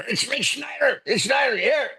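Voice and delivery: high yelled name across the bar; imitating a yell. Comic buddy yelling for Rich across the club. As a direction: call his name out high across the room. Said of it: "He just yells in the bar".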